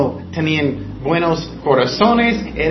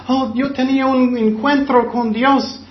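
mock gushing believer; 6 semitones higher than usual. Mocking emotional churchgoers.

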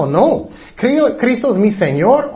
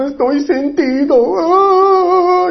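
high, hammed-up whiny moan; self-pitying mock wail; 11 semitones higher, much slower than usual. Preacher mimics Cain feeling sorry for himself, mock wail. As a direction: wail it high like a sulking victim. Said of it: "Él sentía mal por él mismo".

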